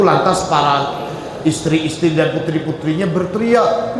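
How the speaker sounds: ordinary talk, nothing marked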